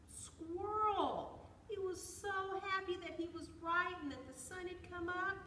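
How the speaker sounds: high, loud, delighted narration; happy excitement. A narrator's reading. Storyteller reveals squirrel was right, high and gleeful. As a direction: lift it high with squirrel's glee.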